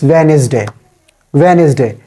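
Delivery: "Wednesday" with the middle e sounded; "Wednesday" in three syllables, starting with v; mispronounced Wednesday. Shown as a demonstration. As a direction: say "Wednesday" as van-is-day. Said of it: "mispronounce this word in this way".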